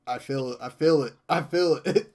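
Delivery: rising from firm to a loud near-shout; building excitement. Retold or imitated. Friend describing how Life is Strange hit him, building to a loud cry. Gushing over the game's emotion.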